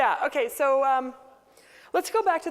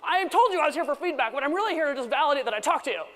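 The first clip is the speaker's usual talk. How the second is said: mock annoyed colleague; 3 dB louder than usual. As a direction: play a defensive colleague snapping shut. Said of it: "And they're like".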